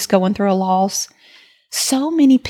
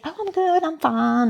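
higher, bright mock cheerfulness, brushing it off; breezy everyday reply; 8 semitones higher, 5 dB quieter than usual. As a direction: toss off a cheery automatic answer. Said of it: "And usually we just say".

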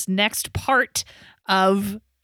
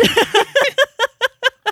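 big, much higher burst of laughter; loud laugh; 4 dB louder than usual. Real, not acted. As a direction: lose it laughing. Laughing at the joke.

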